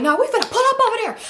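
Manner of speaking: high, rushed and irritated retort. Retold or imitated. Telling cousin she's pulling up, irritated. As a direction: fire it back high and fast, irritated. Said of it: "I'm irritated".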